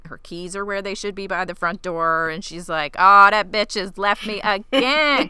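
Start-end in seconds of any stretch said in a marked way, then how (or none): 3.0-5.3 loud laughing line, slow. Retold or imitated. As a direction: laugh through the words.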